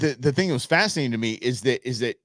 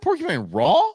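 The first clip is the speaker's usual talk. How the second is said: mocking echo; 8 semitones higher than usual. Real, not acted. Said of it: "Did you really say that?".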